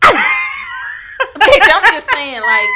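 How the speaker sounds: shrieking through laughter; laughing shout. Real, not acted. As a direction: yell it while cracking up. Shocked outburst at friend's joke.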